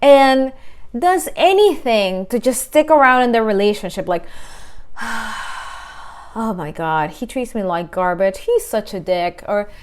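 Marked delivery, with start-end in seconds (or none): 5.0-9.4 weary complaint. Retold or imitated. Exasperated complaining friend quote.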